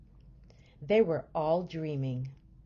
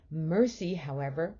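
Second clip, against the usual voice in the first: ordinary delivery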